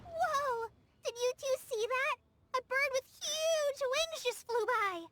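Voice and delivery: high-pitched, wide-eyed wonder; excited amazement. Acted. Pointing out a huge bird to friends, amazed. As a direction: marvel aloud at a sudden sight.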